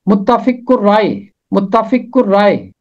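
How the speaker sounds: متفق الرائے as mutafiq urrae, the r doubled; pronunciation demo. Shown as a demonstration. Teacher models the hard word متفق الرائے. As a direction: say متفق الرائے with the lam silent. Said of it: "اس کا تلفظ کرنا بھی مشکل".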